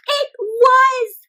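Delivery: high joyful announcement; excited reveal. Acted. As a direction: burst out with the happy news. Reveal of Huangshe.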